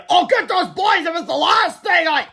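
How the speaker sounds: angry principal. Retold or imitated. Reader voicing the angry principal's threat. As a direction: spit out the threat like a furious principal. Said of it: "would probably have a few more choice words".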